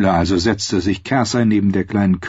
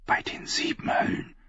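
whispered, much softer oath; whispered curse; 11 dB quieter than usual. Acted. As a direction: curse under her breath. Margeri curses under breath. (geflüsterter Fluch)

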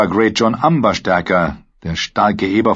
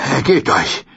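only slightly raised, controlled order; firm demand; 4 semitones higher than usual. Acted. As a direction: say it firmly, no shout. Brienne fordert Aufgabe erneut. (bestimmt, kaum erhoben)

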